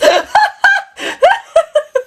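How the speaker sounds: long high giggle; long laugh. Real, not acted. Laughing at her own dream account, long high giggle.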